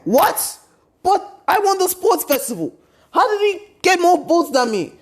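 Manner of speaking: high, indignant squawk of disbelief; high-pitched mock outrage; outraged Bakugo. Bakugo outraged at losing votes.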